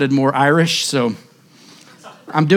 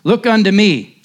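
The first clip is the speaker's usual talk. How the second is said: raised, impassioned old country preacher, a hint of an English accent; a touch of an English country accent, preaching hard; slipping into the preacher's accent; 5 semitones higher than usual. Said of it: "can't help it".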